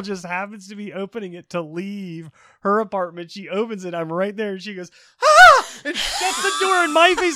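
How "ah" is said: very high, loud shriek; short piercing mock scream; imitated startled scream. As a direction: scream like someone startled at the door. Startled girl opening her door.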